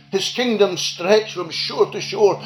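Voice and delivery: usual speaking voice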